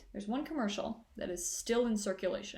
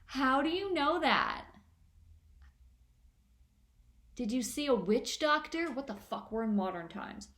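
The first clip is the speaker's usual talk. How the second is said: mock friend; 4 semitones higher than usual. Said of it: "she just goes".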